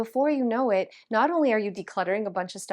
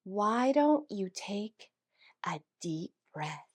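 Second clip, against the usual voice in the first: slow, trailing into a soft whisper; maddeningly calm; 6 dB quieter, slower than usual. Retold or imitated. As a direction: draw it out slowly, breathing the last words. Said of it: "In the time it took him to say".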